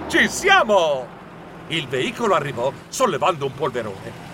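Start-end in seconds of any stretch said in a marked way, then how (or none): 0.1-1.2 slow, raised, drawn-out call; announcing arrival. A narrator's reading. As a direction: announce it to the group, stretching it. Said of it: "Annunciai ai ragazzi". (annuncio esultante e prolungato)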